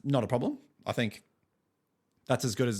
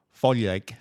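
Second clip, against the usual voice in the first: mock Italian accent; 6 dB louder than usual. Teasing co-host, says the band name in a mock Italian accent. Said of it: "Or is it, like, Italian".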